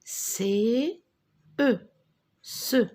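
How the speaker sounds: C, E, then blended into "Ce"; spelling aloud. Shown as a demonstration. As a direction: sound out C and E separately, then join as "Ce". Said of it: "répète après moi".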